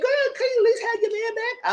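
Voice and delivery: squeaky high, incredulous scold; telling off a friend in a sky-high voice; high shocked squeal. Retold or imitated. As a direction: shriek it up high at her friend. Retold scolding of Amanda.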